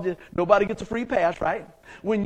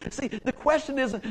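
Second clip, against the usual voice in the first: ordinary delivery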